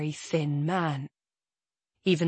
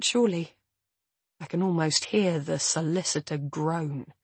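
usual speaking voice

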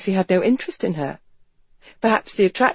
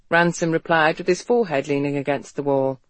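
ordinary delivery

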